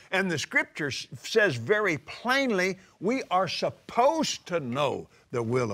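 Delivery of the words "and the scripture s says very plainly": normal talking voice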